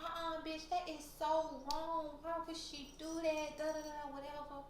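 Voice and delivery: high, drawn-out mock outrage; imitated indignant friend. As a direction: mimic a friend siding with you, high and slow. Imitates the other friend's indignant reaction.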